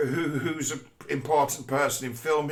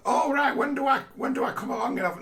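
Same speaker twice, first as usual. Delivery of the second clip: much higher, quick and eager mock voice; naive writer; 13 semitones higher, faster than usual. Writer eagerly asking the studio when he joins, high and quick. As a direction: play the clueless writer, high and hurried.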